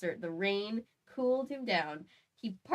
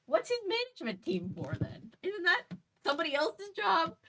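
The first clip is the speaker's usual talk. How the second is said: incredulous; 10 semitones higher than usual. Real, not acted. Mocking outraged fan aside.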